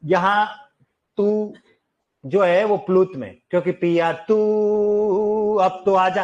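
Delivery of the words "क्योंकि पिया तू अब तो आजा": आजा stretched long and slow, tones held; sing-song drawn-out आजा; prolonged pluta vowels. Shown as a demonstration. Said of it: "ऐसे कहना।".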